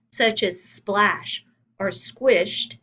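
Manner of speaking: splash and squished drawn out slowly, voiced as the sounds they name; acting out the sounds. Shown as a demonstration. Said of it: "it is called onomatopoeia".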